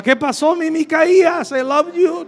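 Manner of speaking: high, syrupy mock-romantic voice; mock sweet talk. Husband teasingly greeting his wife as his Micaías, high mock-sweet coo.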